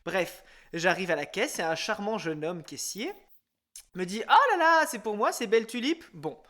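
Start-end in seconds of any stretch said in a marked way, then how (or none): 4.3-6.0 high, gushing mock delight at the start; imitated teasing cashier. Host imitating a cashier joking about his tulips, mock delight. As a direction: put on the cashier's playful fishing line.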